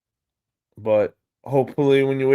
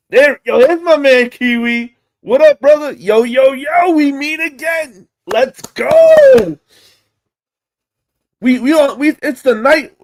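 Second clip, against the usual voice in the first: much higher and louder hype shout-out; excited greeting; 11 semitones higher, 8 dB louder than usual. Real, not acted. Greeting viewer Kiwi in chat, loud excited hype. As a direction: hype up a friend who just joined.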